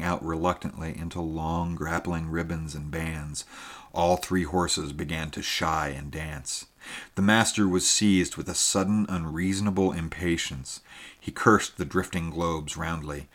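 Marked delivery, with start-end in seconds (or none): none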